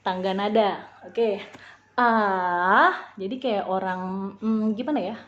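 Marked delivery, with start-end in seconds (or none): 1.9-3.1 "A" dipping, then rising; "A" like a coaxing, pouty whine that swoops up; third tone. Shown as a demonstration. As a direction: draw out "A", down then up. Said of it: "Kayak nada manja".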